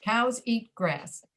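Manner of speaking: "Cows eat grass" slowly, each word its own beat; even stress. Shown as a demonstration. Teacher repeating the example sentence, "Cows eat grass" evenly stressed. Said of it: "stress is pretty even on all three words".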